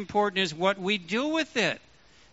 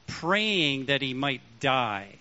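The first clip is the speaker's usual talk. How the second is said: ordinary talk, nothing marked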